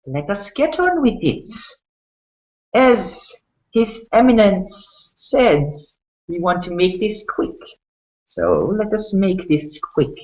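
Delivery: high, gloating villain voice; sadistic half-elf. Acted. Half-elf ordering the burning to begin, high gloating relish.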